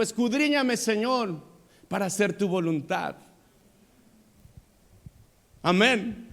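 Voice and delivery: fervent sermon climax, loud and high; impassioned preaching. Acted. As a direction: cry out to God from the pulpit. Preacher's impassioned prayer and exhortation.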